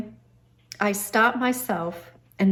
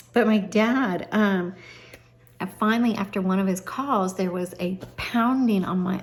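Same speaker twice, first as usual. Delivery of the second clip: ordinary talk, nothing marked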